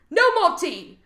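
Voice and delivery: loud, high mock-British cry; mock British outcry. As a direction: cry out in hammed-up British outrage. Mock British outcry, louder repeat.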